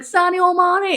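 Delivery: high-pitched Scouse cry; Scouse accent. Shown as a demonstration. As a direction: say it like an excitable Scouser. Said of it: "Scouse accent".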